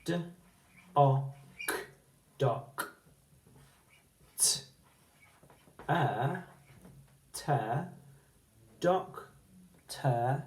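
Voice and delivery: letters and syllables said one by one; spelling out. Shown as a demonstration. Teacher spelling doctor aloud, then its syllables. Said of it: "we can help like this".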